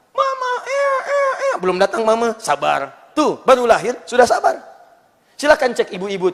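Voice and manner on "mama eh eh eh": put-on very high baby wail; mock infant whimper; newborn baby crying. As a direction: cry out like a newborn calling mama.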